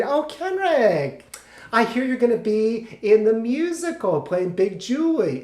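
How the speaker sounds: high, slow, drawn-out gushing; adoring mother. Retold or imitated. Mother greeting her son's friend, gushing high voice. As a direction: coo it high and stretch the name. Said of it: "She just adored him".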